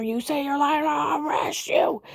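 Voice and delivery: high, drawn-out mimicked shout; shouted threat. Officer threatening a woman with arrest, shouted threat.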